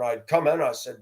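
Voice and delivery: ordinary talk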